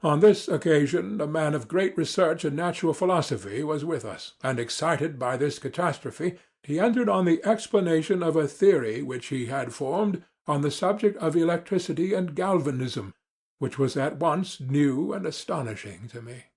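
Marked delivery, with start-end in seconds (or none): none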